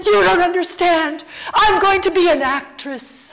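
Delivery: loud, high melodramatic wail; hammed-up tearful declaration, slowing at the end; mock crying child. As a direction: wail it like a heartbroken seven-year-old. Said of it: "I was still crying".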